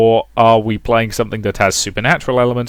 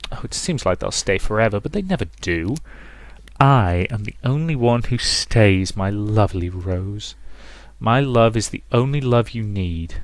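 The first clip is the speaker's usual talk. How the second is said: quiet menace from a possessive mother; 5 dB quieter than usual. Acted. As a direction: croon it softly, drawing out key words.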